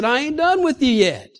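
high, impassioned promise that slows at the end; voicing God with fervent, stretched-out reassurance; God's answer. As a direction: answer the complainer as God, insisting. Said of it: "God said".

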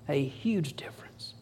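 hushed whisper of the repeated line; breathy whispered echo. Real, not acted. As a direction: drop to a whisper to drive it home.

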